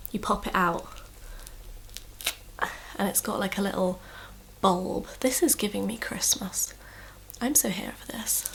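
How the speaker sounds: soft breathy ASMR whisper. Real, not acted. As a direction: whisper it close to the mic. Whispers over slime for ASMR.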